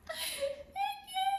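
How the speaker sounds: tiny, very high mock-weepy squeak; tearful gratitude. Nervous applicant told he'll approve it, tearfully grateful. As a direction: squeeze it out softly as if about to cry. Overwhelmed thanks for promised approval.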